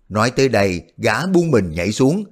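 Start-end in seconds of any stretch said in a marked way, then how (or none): none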